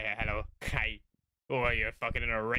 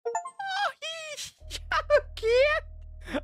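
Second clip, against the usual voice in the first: high-pitched gushing squeal, much louder; squealing with delight; 8 dB louder, slower than usual. Real, not acted. Gushing over the little creature on the bed, high squeal. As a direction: shriek over how cute it is.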